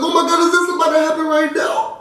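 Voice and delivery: high, thrilled gasp of disbelief; excited squeal. Retold or imitated. Telling fans she reacted to the proposal, high and thrilled. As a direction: squeal like the proposal is finally here. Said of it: "I was like".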